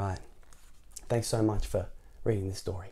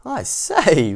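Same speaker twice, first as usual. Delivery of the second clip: laughing exclamation; 9 semitones higher, 9 dB louder than usual. A narrator's reading. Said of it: "laughed Mister Happy".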